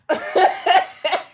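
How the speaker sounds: high, loud burst of laughter. Real, not acted. About to irritate the viewers back, a loud laugh. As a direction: break into a loud cackle.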